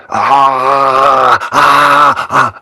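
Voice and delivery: loud re-enacted strained "ah"s; choking cry. Client on the healer's couch, re-enacted choking cries. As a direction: imitate gagging cries in bursts. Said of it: "like, I was choking".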